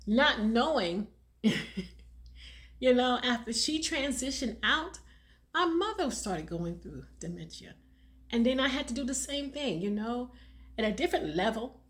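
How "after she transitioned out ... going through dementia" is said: ordinary delivery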